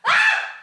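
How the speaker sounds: very high sudden shriek; real scared scream; fearful shriek. Real, not acted. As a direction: scream at the spider. Scared of spider.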